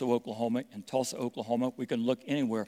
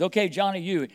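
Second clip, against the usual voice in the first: lightly voiced teacher, higher; teacher calling on a child; 6 semitones higher, 4 dB louder than usual. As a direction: pick out Johnny like a teacher.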